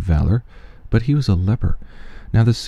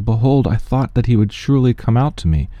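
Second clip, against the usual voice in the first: normal talking voice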